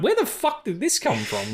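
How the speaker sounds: high, squeaky baffled outburst; baffled squeak. Retold or imitated. Watching the musical bit, a high baffled squeak. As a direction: play your own disbelief as a silly squeak. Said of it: "I'm like".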